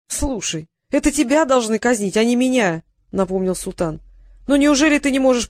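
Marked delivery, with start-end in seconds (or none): none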